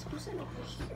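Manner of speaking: whispering. Real, not acted. Whispered aside.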